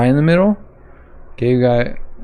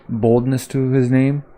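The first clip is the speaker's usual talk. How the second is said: ordinary delivery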